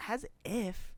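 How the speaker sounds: high, loud mock-scoffing retort; imitated dismissive daughter. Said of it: "his presumably daughter goes".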